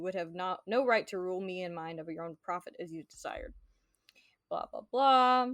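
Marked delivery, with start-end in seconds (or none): 4.5-5.5 mocking blah blah. Mocking the long speech.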